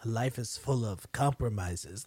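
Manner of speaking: low, slow mock-grave line; solemn quoted pronouncement. Woman quotes a mother's speech to her son, mock-solemn. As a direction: intone it slowly, imitating her.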